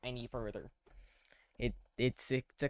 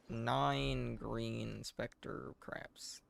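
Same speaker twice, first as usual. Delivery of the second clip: very slow drawn-out mock name; much slower than usual. Real, not acted. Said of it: "that's what they're called now".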